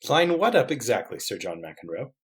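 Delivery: lightly voiced proper professor; a touch of prim puzzlement; puzzled gentleman detective. Plays Layton in script.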